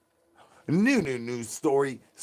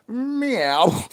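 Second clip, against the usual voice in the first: high cat meow; 8 semitones higher than usual. Acted. Cat noise.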